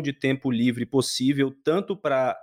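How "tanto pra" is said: ordinary talk, nothing marked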